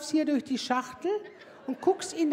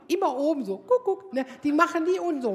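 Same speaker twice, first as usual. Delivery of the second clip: normal talking voice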